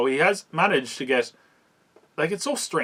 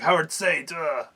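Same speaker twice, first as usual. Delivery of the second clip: retold scream; 4 semitones higher, slower than usual. Frank walks out to confront his enemy, mock scream. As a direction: imitate an action hero bellowing, held back. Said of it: "He goes out and screams".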